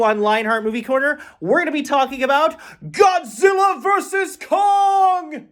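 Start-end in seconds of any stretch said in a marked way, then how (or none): 1.4-5.5 big excited shout, rising high on Kong; hyped announcer shout. Shown as a demonstration. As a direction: bellow the title like a showman. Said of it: "I had to emphasize there".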